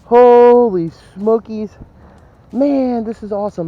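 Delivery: loud, drawn-out, high cry of delight; can't contain the thrill; real excitement. Real, not acted. Detectorist finds a big coin haul, shouting with joy. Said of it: "I'm so excited".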